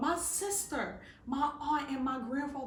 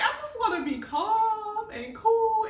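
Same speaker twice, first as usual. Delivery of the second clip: high, slow mock protest; pleading to stay calm; wanting calm; 9 semitones higher, 4 dB louder than usual. Asking the Spirit to let her stay calm, high and slow. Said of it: "I'm like".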